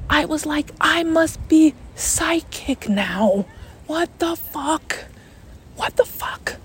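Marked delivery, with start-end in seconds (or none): 0.8-5.1 slow, high, hushed disbelief; acted freak-out. Storyteller reliving her dawning panic, slow high stunned voice. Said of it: "I start freaking the fuck out". 5.8-6.6 stunned, voiceless whisper; freaked-out whisper. Retold or imitated. Storyteller reliving her dawning panic, stunned whisper. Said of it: "I start freaking the fuck out".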